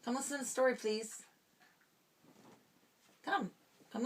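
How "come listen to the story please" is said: ordinary delivery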